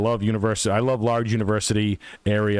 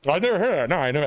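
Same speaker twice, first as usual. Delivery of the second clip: normal talking voice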